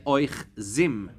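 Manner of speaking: Oich Zim said slowly and deliberately; careful teacher model of Oich Zim; pronunciation demo. Shown as a demonstration. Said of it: "If you wanna say it in the plural".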